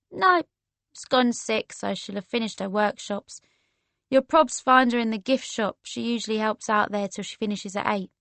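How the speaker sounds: laid-back London chat; casual London woman. Acted. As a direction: explain helpfully in a London accent.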